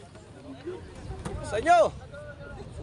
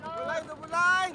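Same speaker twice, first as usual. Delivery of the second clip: calling out while running; 9 semitones higher than usual. Real, not acted.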